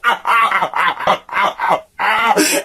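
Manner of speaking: real laugh in a changed voice; burst of laughter. Real, not acted. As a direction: laugh at the absurdity. Laughing at own absurd situation.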